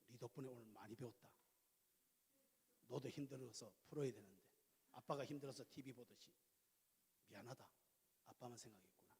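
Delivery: hushed, humble father's apology; a father quietly apologising to his son, hushed; soft apology to son. Retold or imitated. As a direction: say sorry softly, like a humbled dad. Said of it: "그 제가 어떻게 할게요?".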